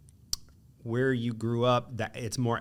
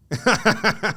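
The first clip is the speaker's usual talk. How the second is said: a sudden loud high laugh; burst of laughter; 11 semitones higher, 9 dB louder than usual. Real, not acted. Laughing at the guess being so wrong. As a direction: laugh out loud, caught off guard.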